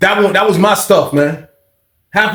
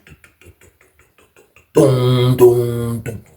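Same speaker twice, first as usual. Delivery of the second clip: slow, low dum-dum beats, stretched out like slow motion; low drawn-out dum sound effect; 5 semitones lower than usual. Retold or imitated. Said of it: "That joke was in slow motion".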